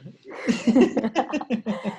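a short real laugh at the story; amused laugh. Real, not acted. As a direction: chuckle at the salesman turned preacher. Laughs at convert salesman story.

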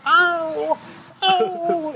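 imitating her high yelps of pain; mock pained cries. Mimicking the woman hit in the face, high mock yelps. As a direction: squeal it out like a hurt woman.

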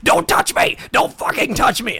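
mock yell. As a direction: yell it like the frantic lady on the train. Retelling lady's outburst on subway.

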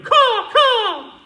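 high, loud croaking caw; screechy raven caw impression; mock raven caw. Shown as a demonstration. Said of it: "that's probably the worst raven you've ever heard".

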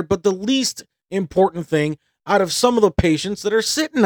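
retold rant to the hospital staff; angry tirade. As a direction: berate the staff as indignation builds. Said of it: "I'm getting mad".

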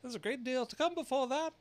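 high, loud fussy rabbit; a nervous herald's squeak. A narrator's reading. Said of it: "the rabbit hastily interrupted".

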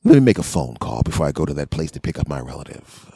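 normal talking voice

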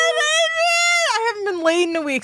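high, shrieking mock swoon; hammed-up flustered cry at Adrian; mock aroused outburst. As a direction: squeal it like she's overcome.

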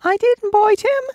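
a big beast whining in protest; high squeaky cowardly lion. A narrator's reading. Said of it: "said the lion".

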